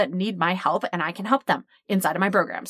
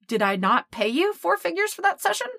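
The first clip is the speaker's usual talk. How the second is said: much higher, disbelieving question; incredulous; 11 semitones higher than usual. Retold or imitated. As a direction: say it like it's obvious.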